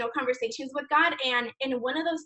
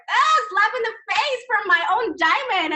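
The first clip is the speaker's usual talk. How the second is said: higher, louder, slowed mock gasp of shock; 8 semitones higher, 5 dB louder than usual. Retelling her diamond's rebuke, playfully mock-shocked. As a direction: play up being stung by her mentor. Retold reaction to being told off.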